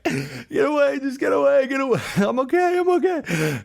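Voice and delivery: very high, frantic panicked squeal; hammed-up high-pitched drowning panic; mock panic. Panicking swimmer waving off helpers, frantic mock squeal. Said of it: "in a full-blown panic".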